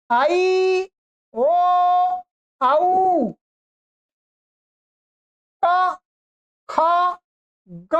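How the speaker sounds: each letter called out clearly with a pause after; teacher recites every letter for students to repeat; one letter at a time, drawn out for the class; reciting the alphabet. Shown as a demonstration. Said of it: "इन वर्णों का सबका उच्चारण एक बार कर लीजिएगा".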